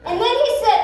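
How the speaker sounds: ordinary talk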